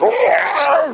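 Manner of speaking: high, hurt-animal cry; imitated dog wail. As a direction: yelp out a mock dying-dog howl. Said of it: "when they killed Old Yeller".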